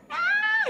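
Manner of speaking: very high short scream; pain scream. Retold or imitated. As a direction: let out a sharp cry of pain. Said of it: "sumigaw ako".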